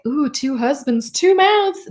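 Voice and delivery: slow teasing ooh rising; titillated delight. Retold or imitated. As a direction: savor it, thrilled and teasing. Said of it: "'cause it's like".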